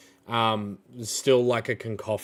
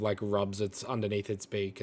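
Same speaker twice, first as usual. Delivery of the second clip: ordinary talk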